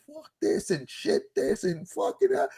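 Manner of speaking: high mock rage cursing; squeaky imitation of gamers swearing. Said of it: "we'll be like".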